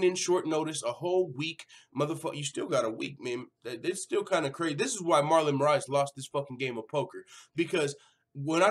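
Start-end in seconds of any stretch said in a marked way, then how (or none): none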